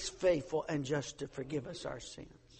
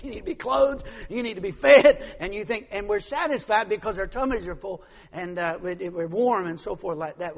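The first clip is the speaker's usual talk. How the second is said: preaching; 8 dB louder than usual. Acted. Loud preaching.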